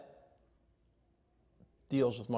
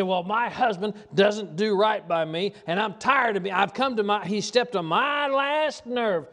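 high, loud, drawn-out mock whine; mock nagging wife voice, pitched up and loud; whiny complaining wife; 9 semitones higher, 7 dB louder than usual. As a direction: ham up a fed-up wife griping about her husband. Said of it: "You say".

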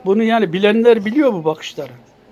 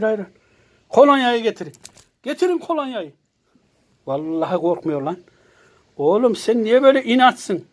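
normal talking voice

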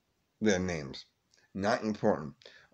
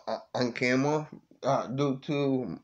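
ordinary talk, nothing marked

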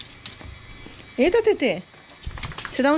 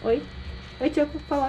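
ordinary talk

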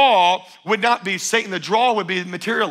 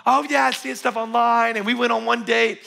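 lightly mocked starry-eyed eagerness, higher; eager gushing; 4 semitones higher than usual. Dater assuring the pastor they know him, eager gushing. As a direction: play a smitten dater brightly sure of it.

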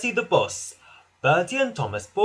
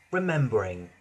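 ordinary delivery

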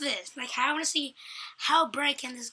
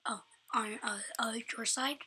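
whispering; 8 dB quieter than usual. Real, not acted. Confused quiet reply.

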